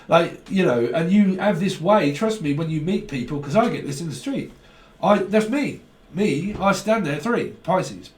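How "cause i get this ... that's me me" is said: normal talking voice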